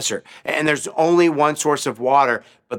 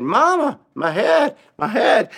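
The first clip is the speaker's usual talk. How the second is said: put-on sick child; 8 semitones higher, slower than usual. Sick boy calling to his mother.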